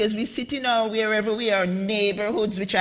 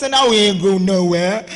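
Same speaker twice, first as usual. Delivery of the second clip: mock Texas accent; 2 semitones lower, 8 dB louder than usual. Speaker mocking accents of Texas, loud Texan imitation. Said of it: "Texas says".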